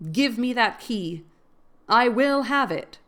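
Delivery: loud, slow, insistent demand; passionate demand. A narrator's reading. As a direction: insist on the key, each word pressed. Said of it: "flashing with passion and resolution".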